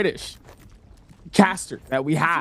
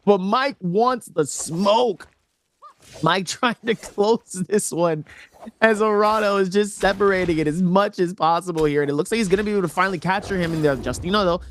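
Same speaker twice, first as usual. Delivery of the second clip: energetic caster commentary, rising as the combo lands; live play-by-play; 5 semitones higher than usual. Real, not acted. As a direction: call the action and build excitement with each hit.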